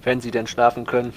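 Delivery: ordinary talk, nothing marked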